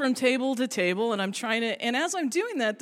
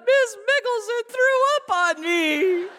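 put-on high, loud whining child; hammed-up tattling child, high and loud; whiny kid tattling; 12 semitones higher, 7 dB louder than usual. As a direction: tattle like a wronged little kid. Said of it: "this little kid turns around".

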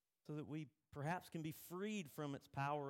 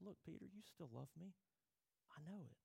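softer and higher, gently knowing; tender reassurance; 4 semitones higher, 8 dB quieter, faster than usual. Retold or imitated. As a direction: reassure him softly, like a friend. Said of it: "He's like".

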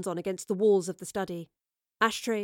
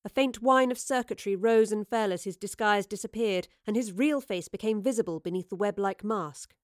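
plain reading voice

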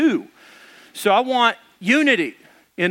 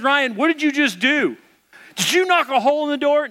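higher-pitched, imitated interrogation; mock accusing wife; 5 semitones higher than usual. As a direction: act out her suspicion, pitched up. Wife interrogates husband about hole.

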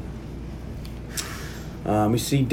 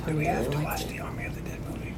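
soft, breathless whispered question; 3 semitones higher, 13 dB quieter than usual. Real, not acted. Co-host whispering a question to friends about the movie, hushed. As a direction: lean in and whisper it.

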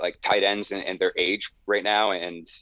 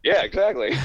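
laughing; 9 semitones higher, 5 dB louder than usual. Real, not acted. Laughing after joke.

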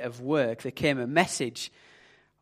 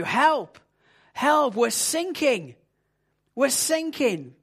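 desperate mayday shout, pitched up high; panicked cry for help; 8 semitones higher, slower than usual. Acted. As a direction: yell it like a sailor whose ship is going down. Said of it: "the voice on the radio sounded filled with sort of panic".

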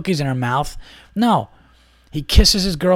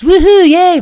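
high, loud sarcastic woohoo; shrieking mock party cheer; 12 dB louder, slower than usual. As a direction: ham up a fake celebration. Mocking a celebration with confetti.

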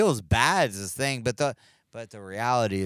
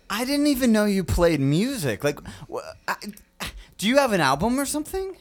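excited fan voice; 11 semitones higher, 4 dB louder, faster than usual. Acted. Brendan character.

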